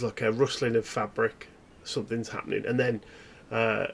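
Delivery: normal talking voice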